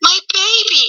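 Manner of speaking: lightly voiced gothic mother; smooth Morticia voice. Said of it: "In that smooth voice".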